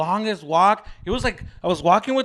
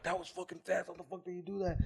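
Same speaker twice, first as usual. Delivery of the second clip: muttered thought; 15 dB quieter than usual. Retold or imitated. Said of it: "And I'm like".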